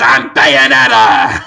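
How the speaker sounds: low, loud slow declaration; hammed-up Bayonetta. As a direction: proclaim it to camera. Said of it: "looks at the camera and goes".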